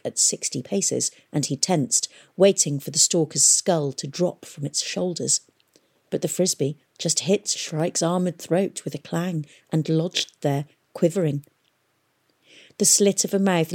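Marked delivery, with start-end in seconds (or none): none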